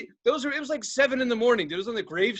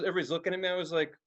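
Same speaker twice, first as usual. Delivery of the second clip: ordinary talk, nothing marked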